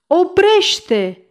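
high, loud repeated cry; shouted stop. A narrator's reading. The mayor shouts a second time to stop the ladder, loud and high. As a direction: shout it again, just as urgent. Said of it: "primarul strigă".

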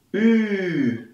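a long, rounded Ü sound; long German Ü. Shown as a demonstration. Teacher modelling a long Ü for learners. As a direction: hold the Ü long with rounded lips. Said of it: "Das lange Ü spricht man".

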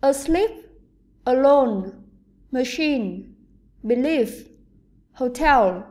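"Asleep", "alone", "machine", "believe", "hotel", each stressed on the second syllable; weak first syllable, strong second in "Asleep", "alone", "machine", "believe", "hotel"; word-list demo, beat on the last syllable of "Asleep", "alone", "machine", "believe", "hotel". Shown as a demonstration. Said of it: "trọng âm sẽ rơi vào âm tiết thứ hai".

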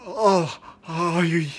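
high, loud gasp falling into breathless sighs; mock drug rush. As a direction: act overwhelmed by a sudden hit. Skit, reacting after snorting powder.

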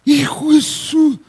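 high, drawn-out mock shock; mock exclamation. Preacher teasing churchgoers about their football, mock shock. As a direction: stretch it out high, as if scandalized.

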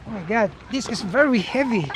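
ordinary talk

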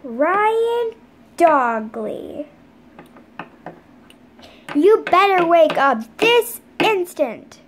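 loud, slow, stern scolding; stern mom. Pretend. Mom ordering her sleeping son out of bed, stern and loud. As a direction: call him by full name, no nonsense.

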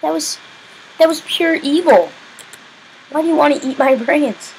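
high, loud outraged complaint; real exasperated gamer yell; indignant outcry. Real, not acted. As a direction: protest at the zombies. Indignant at zombies.

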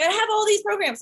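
high, worked-up retold cry; exasperated. Telling her friend about her unused programs, exasperated. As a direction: blurt it out, exasperated. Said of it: "And I was like".

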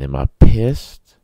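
loud, slow, rising rhetorical question; pissed off. Real, not acted. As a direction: ask it slow and loud like you're seething. Fuming over roommate's flushing.

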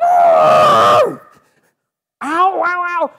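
loud, high mock yelps of pain; hammed-up high shouts of ow; elephant yelps. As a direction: yelp like a stung elephant. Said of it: "And the elephant goes".

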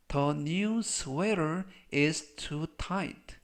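very slow, drawn-out words; slow model reading. Shown as a demonstration. Teacher models the sweater sentence for learners, slow model reading. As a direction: stretch each word out for learners to copy.

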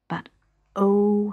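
usual speaking voice

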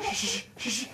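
unvoiced hush; shushing. Teacher hushing kids before the shark appears. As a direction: hush everyone dramatically.

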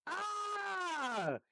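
sudden high yelp. Real, not acted. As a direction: yelp out in shock. Hit in the face in game.

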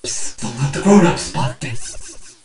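hushed, secretive aside; conspiratorial hush. Acted. Keeping it from the grown-ups, conspiratorial hush. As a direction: keep it low, like a secret.

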